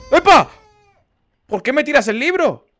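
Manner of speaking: high, loud shocked protest; yelp, then protest in shock; high-pitched startled outburst; startled yell. Real, not acted. Startled by a thrown book.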